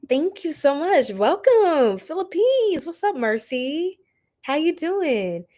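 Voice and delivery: high, drawn-out singsong greeting; gushing welcome. Real, not acted. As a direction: greet them slowly in a lilting high voice. Greeting viewers joining the live stream.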